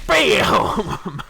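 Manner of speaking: high, loud triumphant shout. Real, not acted. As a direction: yell it in triumph. Sickest thing ever.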